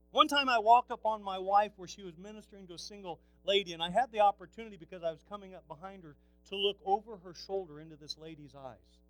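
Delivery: ordinary talk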